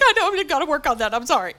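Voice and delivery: high, rushed whimpering apology; hurried, comic crying voice; mock tearful apology. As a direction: imitate yourself blubbering to the boss. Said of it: "And I'm just squalling".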